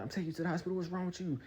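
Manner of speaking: high-pitched mock girlfriend voice; upset girlfriend. Girlfriend driving him to hospital, mock upset scolding. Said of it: "The girl drives me".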